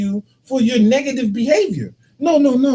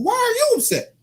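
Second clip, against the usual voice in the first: much higher, exasperated questions; 8 semitones higher than usual. Real, not acted. Husband telling wife he's the hurt one, high and exasperated. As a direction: plead your case, pitched high. Husband arguing about crying.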